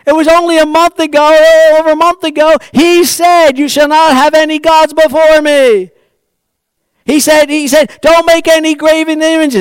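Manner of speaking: Aaron calling out high and loud to the crowd; a high priest raising his voice to warn the people; pretend Aaron pleading. Aaron begging the people to remember God's law, in a high, loud voice.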